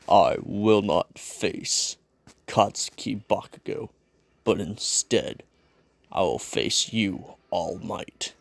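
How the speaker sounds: slow, low, measured declaration; solemn armored challenger. Acted. Armored fighter challenges his teacher, slow low solemn vow. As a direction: lay down the challenge slowly in a low voice.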